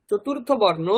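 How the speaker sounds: ordinary delivery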